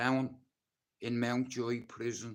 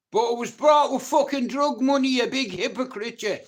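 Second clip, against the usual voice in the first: much higher and far louder, furious; angry shout; 12 semitones higher, 11 dB louder than usual. Real, not acted. Ex-prisoner lashing out at his millionaire ex-mates, furious shout. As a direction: explode at them, calling them hypocrites.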